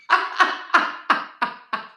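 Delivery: sudden high breathy laugh. Real, not acted. A big laugh at his own joke. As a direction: burst out laughing.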